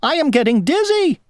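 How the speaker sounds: high-pitched, distressed squeal; dizzy cry. A narrator's reading. Worm spinning inside the apple, high panicked cry. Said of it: "cried the worm".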